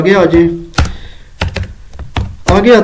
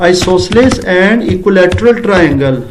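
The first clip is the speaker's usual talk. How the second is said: slow dictation; much slower than usual. Shown as a demonstration. Teacher reads a wrong question aloud while writing, slow. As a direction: read the faulty question word by word, slowly. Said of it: "short لکھ رہا ہوں".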